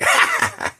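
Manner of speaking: shrill mock laugh, sudden and high; high cackle. Monkey laughing after peeing on the donkey, high cackle.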